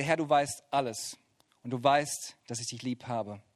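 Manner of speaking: low, soft, hurt confession; Peter, saddened, answering Jesus softly; sad quiet reply. Acted. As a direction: answer as a grieved friend.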